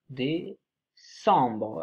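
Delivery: décembre drawn out very slowly, syllable by syllable; dictating décembre, stretching each syllable; slowly stretched. Shown as a demonstration. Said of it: "word said slowly after spelling".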